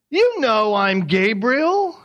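slow, high "You" and a questioning lift on "Gabriel"; "You know I'm Gabriel" drawn out, swinging up at the end; asked as a question. Acted. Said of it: "he's not asking a question".

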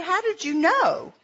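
asking how he could possibly know; surprised. Retold or imitated. Caller on phone to publisher, amazed he knew her.

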